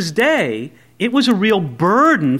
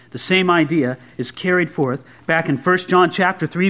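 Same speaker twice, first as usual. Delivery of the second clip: ordinary delivery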